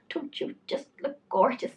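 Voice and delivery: lightly voiced gushing lady; a hint of a sweet stranger. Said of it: "She's like".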